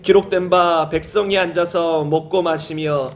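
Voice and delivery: low, even, measured read-aloud; scripture reading. Real, not acted. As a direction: read the verses in a low, steady chant-like cadence. Reads 1 Corinthians passage aloud.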